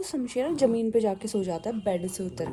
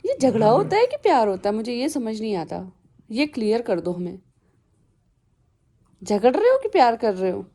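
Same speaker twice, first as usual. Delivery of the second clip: louder playful questions; teasing the dogs; 7 dB louder than usual. Real, not acted. Owner asking her dogs whether they fight or love, loud playful. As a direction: tease them, loud and playful.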